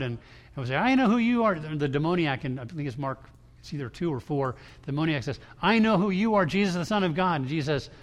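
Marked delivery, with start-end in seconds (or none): 0.8-1.6 a demon's raised cry, higher and louder. Retold or imitated. Demon shouting at Jesus from the crowd, raised cry. 5.6-7.4 a demon's raised cry, higher and louder. Retold or imitated. Demon shouting at Jesus from the crowd, raised cry.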